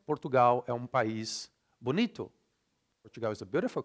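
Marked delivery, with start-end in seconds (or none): 0.1-2.3 Brazilian reading with full, even syllables; Brazilian Portuguese accent. Shown as a demonstration. Teacher reading a sample phrase, Brazilian Portuguese accent. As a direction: read it like a Brazilian, every vowel sounded. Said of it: "I'll read like a Brazilian".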